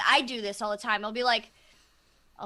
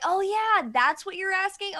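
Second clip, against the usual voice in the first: high, louder surprised realization; sudden lightbulb, much higher; surprised client; 8 semitones higher, 6 dB louder than usual. Retold or imitated. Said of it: "And they'll be like".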